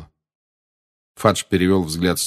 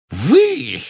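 ordinary delivery

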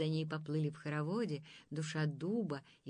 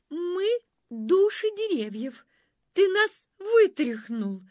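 high, loud spirit voice; tree spirit voice; 12 semitones higher, 13 dB louder, slower than usual. A narrator's reading. First soul answering the monster, high and loud. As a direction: speak as a startled tree soul.

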